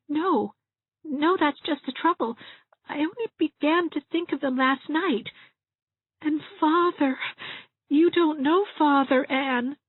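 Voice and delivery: high, wavering little whimper; tearful Dovey, fretting. A narrator's reading. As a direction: fret helplessly in a high tearful voice.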